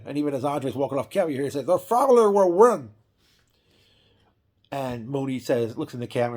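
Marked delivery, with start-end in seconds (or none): 1.6-2.9 higher, louder put-on giant's voice; mock Andre declaring it one last time; imitating Andre. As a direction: boom out the vow as you walk away. Andre repeats vow walking off.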